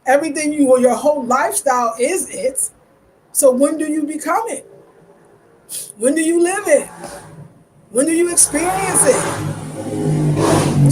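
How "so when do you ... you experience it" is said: building rhetorical questions. Real, not acted. Rising rhetorical questions about becoming African.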